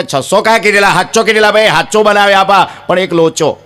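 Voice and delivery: high-pitched back-and-forth on the phone; phone caller. Retold or imitated. Men haggle over the card count by phone, high acted voices. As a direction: argue it high, down the line. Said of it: "તો ઓલો કે".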